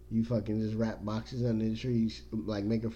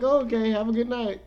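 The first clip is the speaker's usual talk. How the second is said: high-pitched pretend politeness; mock goodnight; 10 semitones higher, 7 dB louder than usual. Scared parent to threatening child at bedtime, mock-polite. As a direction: play a hammed-up, high goodnight.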